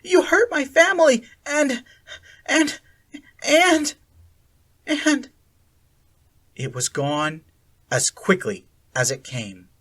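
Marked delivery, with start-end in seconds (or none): 0.0-5.3 high, loud shout losing steam; shouting, faltering. A narrator's reading. Akira shouting at Sally Ann, then faltering. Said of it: "Akira shouted at Sally Ann".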